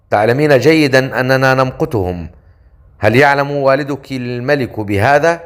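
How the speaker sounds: ordinary delivery, nothing marked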